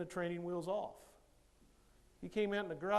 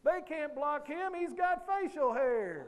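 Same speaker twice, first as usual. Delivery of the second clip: parents yelling; 8 semitones higher, 4 dB louder, slower than usual. Retold or imitated. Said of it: "Parents are yelling from the stands".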